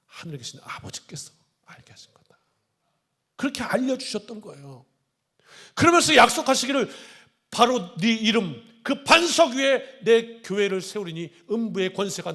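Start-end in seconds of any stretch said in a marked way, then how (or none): 0.1-2.4 whisper. Retold or imitated. Jesus revealing softly. 7.5-12.3 solemn promise. Retold or imitated.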